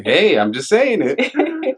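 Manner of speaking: high, drawn-out laughing defence; laughing protest. Real, not acted. Teased by cohost over a mispronounced name, laughing protest.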